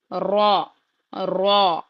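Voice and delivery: را said full and heavy; a weighty را. Shown as a demonstration. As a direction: give را a thick, heavy sound. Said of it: "را کو موٹا پڑھیں گے".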